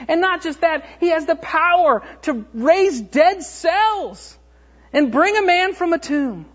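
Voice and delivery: high-pitched, urgent preacher's delivery; fervent sermon at full pitch; impassioned preaching. Real, not acted. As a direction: drive each point home with mounting fervor. Preaching builds to full force.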